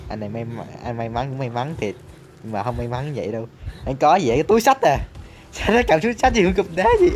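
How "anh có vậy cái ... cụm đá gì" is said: surprised. Real, not acted. Surprised at enemy's item.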